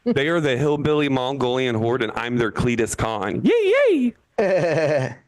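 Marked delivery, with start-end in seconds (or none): 3.5-5.2 high, loud yay; mock cheer. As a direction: cheer like a triumphant khan.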